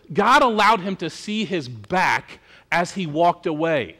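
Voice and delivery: usual speaking voice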